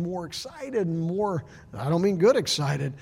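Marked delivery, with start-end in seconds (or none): none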